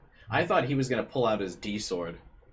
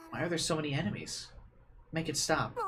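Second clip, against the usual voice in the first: distressed plea; 8 semitones higher than usual. Acted. Girl despairing over enemies.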